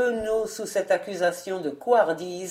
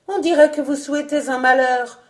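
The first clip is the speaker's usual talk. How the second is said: higher, louder sharp retort; sour outcry; 6 semitones higher, 7 dB louder than usual. A narrator's reading. Chambermaid snapping at Goton, loud and sour. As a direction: bite back at the old woman. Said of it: "s'écria aigrement Renée".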